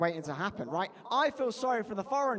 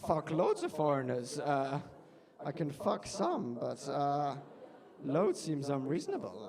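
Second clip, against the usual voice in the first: low, halting voice of a puzzled foreign worker; hesitant Polish newcomer; 6 semitones lower, slower than usual. Acted. [name] weighs up a baffling instruction, low hesitant voice. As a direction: play [name] taking it literally, low and unsure. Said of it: "[name], I'm like".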